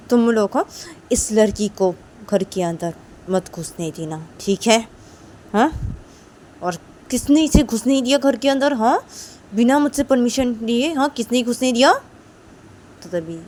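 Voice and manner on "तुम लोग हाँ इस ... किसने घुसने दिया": loud, high, demanding who let her in; angry scolding. Acted. Mistress angrily scolding her guards. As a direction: snap at the guards. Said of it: "सारे guard को बोलती कि".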